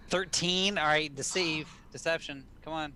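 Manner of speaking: loud, high reaction to the roll; excited. Real, not acted. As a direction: call the result out keenly. Checking dice result excitedly.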